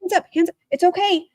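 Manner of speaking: mock soothing parent. Mocking parents soothing upset daughter.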